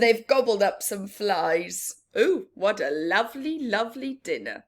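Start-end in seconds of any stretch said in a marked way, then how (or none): none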